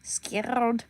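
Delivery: taunting in a put-on Russian accent; repeated teasing challenge with a Russian accent. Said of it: "I'll get that Russian accent down".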